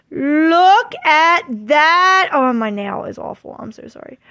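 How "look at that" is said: very slow, drawn-out marvelling; awed delight. Real, not acted. Vlogger showing off her new doll, awed delight. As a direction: stretch every word in amazement.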